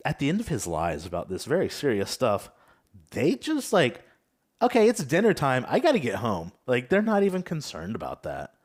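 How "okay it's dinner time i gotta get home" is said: louder, higher mock kid quote; casual kid excuse. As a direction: imitate a kid breezily excusing themselves. Imitates unconcerned kids abruptly leaving.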